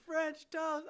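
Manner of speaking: imitated French accent. Cohost retelling the song's punchline, imitated French accent. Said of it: "like, a French accent".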